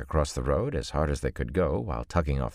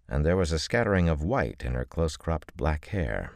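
normal talking voice

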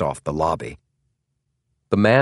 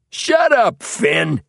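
sharp, very high, loud rebuke; angry snap; 14 semitones higher, 5 dB louder, much slower than usual. A narrator's reading. Tall man silencing his brother, angry snap. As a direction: cut him off with a high shout.

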